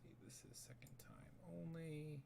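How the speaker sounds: hushed muttering under the breath; a private whispered note to self; whispered aside. Real, not acted. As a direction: whisper it to yourself while working. Muttering to himself while editing.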